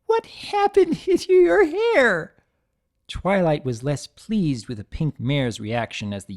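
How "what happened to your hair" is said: very high, slow, laughing question; giggling through words. Acted. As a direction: she can barely speak for laughing. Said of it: "choked between giggles and hiccups".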